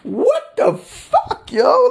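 high, squeaky mock outrage; shrill cartoon disbelief; mock confused. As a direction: play the baffled fool, way up high. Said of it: "Y'all is like".

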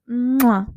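loud "mwah" kiss noise; exaggerated sign-off kiss; blown kiss. Real, not acted. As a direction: send listeners a big smacking kiss. Blows a kiss signing off.

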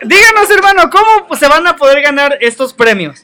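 loud, high hype-man call; excited shout. Real, not acted. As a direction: shout it out to the crowd. Host hypes the prize game.

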